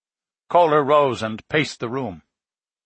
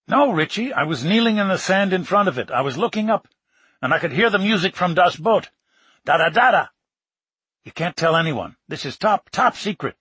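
higher, fast excited rush; 5 semitones higher than usual. Acted. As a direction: gush about the discovery. Excited retelling of the find.

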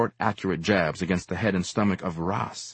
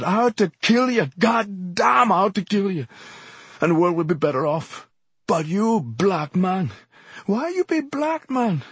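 high, tearful, cursing rant; beginning to cry; 12 semitones higher, 3 dB louder than usual. A narrator's reading. Exhorter with raised knife over his foe, tearful high outburst. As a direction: rage cracking into tears. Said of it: "beginning to cry now".

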